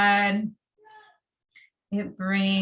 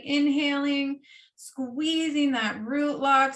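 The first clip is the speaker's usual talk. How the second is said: louder, higher guided cues; yoga instruction; 4 semitones higher, 3 dB louder than usual. Acted. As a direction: drive the energy upward. Leads the breath hold.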